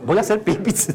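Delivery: lightly voiced boy making an excuse; mock kid excuse. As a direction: play a kid hiding tears. (niño fingiendo que va al baño)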